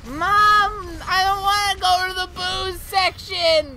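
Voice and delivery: put-on high, loud kid's wail; mock crying boy. Retelling boy dragged through the store by mom, mock crying. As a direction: imitate a son wailing at his mom. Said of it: "He was, like, crying".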